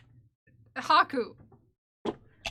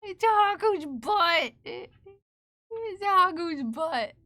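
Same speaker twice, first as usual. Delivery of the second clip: drawn-out teasing call, louder and higher; playful singsong; 4 semitones higher, 5 dB louder, much slower than usual. Pretend. Owner teasing cat whose butt fills the camera, drawn-out singsong. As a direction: stretch it out slowly, teasing the cat.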